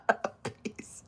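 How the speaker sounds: whispering. Real, not acted. Whispered plea.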